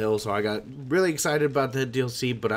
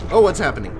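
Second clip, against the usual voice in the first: usual speaking voice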